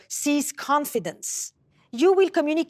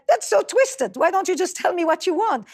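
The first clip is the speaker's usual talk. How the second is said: high, quick protest; acted exasperation, pitch jumping up; exasperated husband; 6 semitones higher, faster than usual. Husband fed up with wife's indirect asking, acted exasperation.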